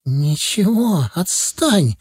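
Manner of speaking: mild cry, holding back tears; slow, strained outburst, barely holding it together; tearful cry. A narrator's reading. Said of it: "крикнула она, едва сдерживая слёзы".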